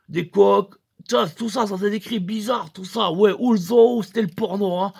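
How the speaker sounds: ordinary talk, nothing marked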